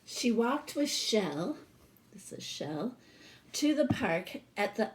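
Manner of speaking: plain reading voice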